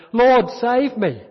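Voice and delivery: loud, slow desperate cry; a drowning man crying out; cry for help. A narrator's reading. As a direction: call out to be saved as you sink. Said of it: "crying out".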